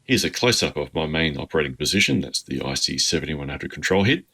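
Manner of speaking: ordinary talk, nothing marked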